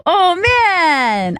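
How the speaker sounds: ordinary talk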